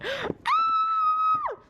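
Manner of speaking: very high loud shriek; piercing excited squeal; excited gasp and squeal. Real, not acted. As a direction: gasp then shriek with joy. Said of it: "Can you tell I'm excited".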